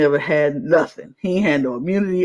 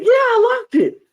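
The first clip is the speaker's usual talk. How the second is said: much higher laughing reply; mock confident; 4 dB louder than usual. Imitating the player about the cage, high laughing confidence. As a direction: say it with cheerful certainty. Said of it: "He's like".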